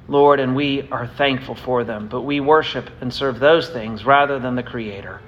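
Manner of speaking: closing prayer. Real, not acted. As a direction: pray humbly before the congregation. Pastor leads closing prayer.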